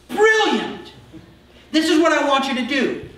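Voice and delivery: high, loud, booming enthusiasm; a king thrilled with the idea, giving orders; delighted king. Retold or imitated. The king ordering Haman to honor Mordecai, high and loud with delight.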